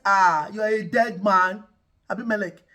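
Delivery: God booms at the king in his dream; God's warning. Retold or imitated. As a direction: thunder the warning at Abimelech. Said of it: "God appears to him in a dream and says".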